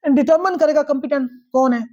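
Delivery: ordinary talk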